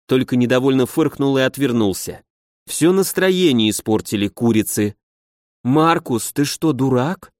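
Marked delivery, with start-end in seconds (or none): none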